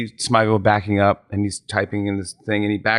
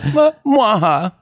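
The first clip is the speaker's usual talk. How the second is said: hammy vampire chuckle; Dracula laugh; 11 semitones higher, much slower than usual. Retold or imitated. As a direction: laugh like a mustache-twirling Dracula. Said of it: "He goes".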